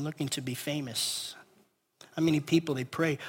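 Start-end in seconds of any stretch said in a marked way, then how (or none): none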